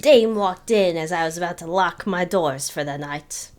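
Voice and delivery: lightly voiced hard-boiled detective; a hint of gumshoe voiceover; noir detective. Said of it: "grizzled detective".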